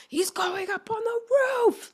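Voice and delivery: put-on high, thrilled child voice; squealing four-year-old delight; excited. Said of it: "he was like".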